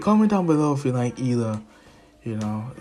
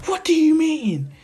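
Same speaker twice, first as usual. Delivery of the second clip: very high, loud "what do you mean"; shrieking indignant question; high indignant squeak; 7 dB louder than usual. Retold or imitated. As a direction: squeal back in protest. Indignant reply in quarrel.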